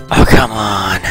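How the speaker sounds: normal talking voice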